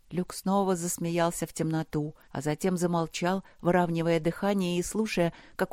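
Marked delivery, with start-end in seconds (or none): none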